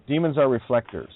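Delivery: usual speaking voice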